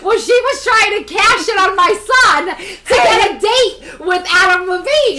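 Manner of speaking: hammed-up indignant shout; mock accusation. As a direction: call her out loudly in playful outrage.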